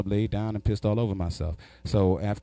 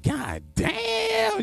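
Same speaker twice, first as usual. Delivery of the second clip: very high, stretched-out damn; amazed exclamation. Retold or imitated. Challenger marvels at friend's lyrics, high mock astonishment.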